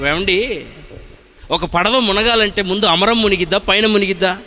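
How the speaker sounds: normal talking voice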